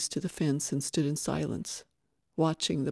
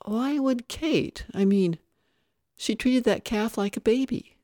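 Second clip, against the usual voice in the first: higher-pitched, puzzled protest; baffled disbelief; 6 semitones higher than usual. A narrator's reading. Foreman questioning whether Kate killed the calf, baffled. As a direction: question it in rising bewilderment.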